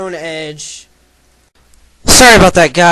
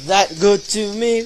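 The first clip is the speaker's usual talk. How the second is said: ordinary delivery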